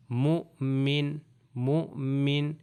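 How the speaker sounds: مؤمن with a light catch on the hamza; glottal catch in mumin. Shown as a demonstration. Teacher drilling مؤمن with a slight hamza jolt. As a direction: say مؤمن slowly, a small jolt after مُ. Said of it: "صحیح طریقے سے پڑھنے کا طریقہ ہے مؤمن".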